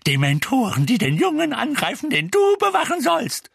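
high, loud, furious reproach; screeching. A narrator's reading. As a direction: scold at full pitch. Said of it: "kreischte Misses Figg".

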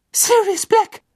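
high terrified scream of a name; shrieking. A narrator's reading. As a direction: shriek it in horror. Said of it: "kreischte sie".

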